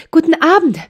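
put-on high, out-of-breath child's greeting; breathless girl calling. A narrator's reading. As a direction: call out breathless after running. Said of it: "rief es noch außer Atem". (atemlos rufendes Mädchen)

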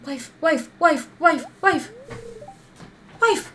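frantic high calls; screaming at a spider. Real, not acted. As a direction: call out in panic. Calling wife during attack.

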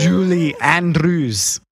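slow mock Stargate accent that slips toward Irish; aggressive, drawn-out Stargate accent with an Irish tinge; botched alien accent sounding aggressively Irish; Stargate accent turning Irish. Shown as a demonstration. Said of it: "now it sounds Irish".

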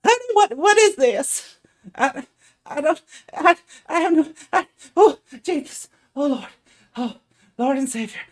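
high, loud, flustered woman; flustered halfling woman. Acted. Halfling woman holding the stylist card, high flustered babble. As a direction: stammer it out, high and overwhelmed. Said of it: "and she's like".

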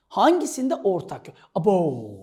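ordinary delivery

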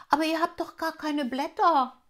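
hohe, laute Elfenstimme; kleine Elfe. Acted. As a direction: staunend fragen wie ein kleines Mädchen. Elfe Rubinia fragt. (Rubinia fragt den Tropfen, höher und lauter)

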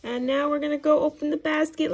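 usual speaking voice

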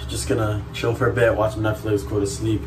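ordinary talk, nothing marked

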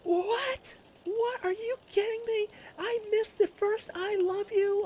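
falsetto shrieked disbelief, imitating the wife; very high, incredulous mock shriek; wife losing it. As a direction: lose it over the missed milestone. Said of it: "And she loses".

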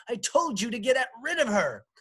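high, loud, fast rebuke; exasperated wife shouting at her husband; angry shout. A narrator's reading. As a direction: yell it furiously in a rush. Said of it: "shouted Jane".

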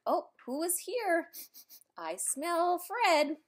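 high, slow, sniffing curious rhino voice. Acted. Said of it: "they sniff the poop and say".

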